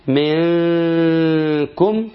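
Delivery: slow, drawn-out ikhfa with long nasal hum on the N; slow tajweed recitation; Quranic recitation. Shown as a demonstration. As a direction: recite منكم stretching the hidden nun into a long ghunna. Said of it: "حكم الإخفاء".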